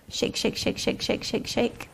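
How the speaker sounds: whispered shake, shake, shake; breathy whispered chant; whispering. Shown as a demonstration. Teacher whispers action word to child.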